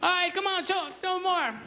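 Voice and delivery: high, strained trainer urging; elephant trainer voice. Retold or imitated. Trainer at the circus urging the elephant, high and strained. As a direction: coax the elephant like a nervous handler. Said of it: "and the elephant goes".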